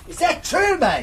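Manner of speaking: high, amazed fake Aussie; mock Australian accent. Mate amazed sun never sets, mock Australian accent. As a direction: squeak it as a badly faked Australian. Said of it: "they're very poor Australian accents".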